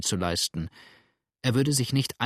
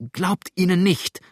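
higher, louder, fearful plea; terrified pleading; 7 semitones higher, 5 dB louder than usual. A narrator's reading. Adele warning James against the men, terrified pleading. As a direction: break in with a frightened warning. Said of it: "bat Adele in Todesangst".

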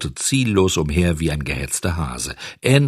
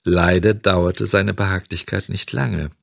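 ordinary delivery

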